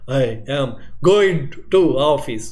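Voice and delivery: very slow, word by word, with uneven bursts of volume; staccato speech. Shown as a demonstration. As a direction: break every word apart as in abnormal, jerky speech. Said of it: "So this is now something abnormal".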